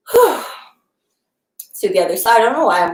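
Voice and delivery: slightly winded talking; out of breath. Real, not acted. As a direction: speak short of breath after exercise. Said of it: "like, out of breath".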